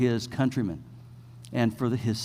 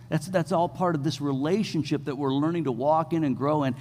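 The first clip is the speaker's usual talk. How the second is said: normal talking voice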